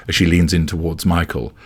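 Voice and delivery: ordinary delivery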